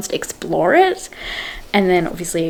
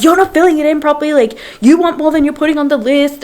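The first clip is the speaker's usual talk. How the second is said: heated retort; 7 semitones higher than usual. Retold or imitated. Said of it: "they're, like, really heated".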